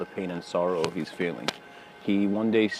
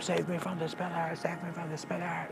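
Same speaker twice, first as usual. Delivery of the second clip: lightly voiced high, softer whine of a madman; a hint of the crazed pillar-hugger, quieter; higher, softer mock plea that keeps repeating; mock pleading; 9 semitones higher, 7 dB quieter than usual. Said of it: "the guy's nonstop".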